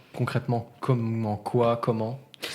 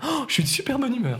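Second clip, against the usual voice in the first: excited; 11 semitones higher than usual. Retold or imitated. Mimics friend's cheerful arrival.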